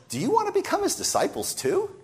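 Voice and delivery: high, mock-innocent question with a laugh in it; taunting innocence. Healed man taunting the court with mock innocence. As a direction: play dumb to needle them. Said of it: "He has a sort of taunting show of naivete".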